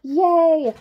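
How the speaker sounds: high, loud yay; cheer. A narrator's reading. Narrator cheering with children. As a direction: celebrate out loud. Nibbles back home.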